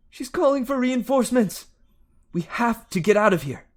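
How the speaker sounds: high, loud frantic warning; urgent shouting. Acted. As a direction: shout it over the storm. Annabeth urging escape.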